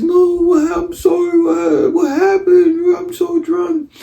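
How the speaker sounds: very high, drawn-out mock wail; hammed-up whiny falsetto blubbering; crying drunk girlfriend. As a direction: imitate a drunk girlfriend sobbing an apology.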